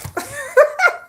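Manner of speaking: high, loud burst of laughter; amused laugh. Real, not acted. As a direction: crack up at yourself. Laughing at her own habit.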